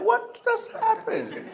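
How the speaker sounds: very high, slow squeak of disbelief; baffled amazement. Retold or imitated. Husband reacts to wife's sudden recovery, baffled. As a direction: draw it out in comic shock.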